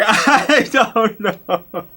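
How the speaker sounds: very slow, high, laughing through it; laughing helplessly. Acted. Guide failing again, slow high giggling shrug. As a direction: let the laugh take over the words.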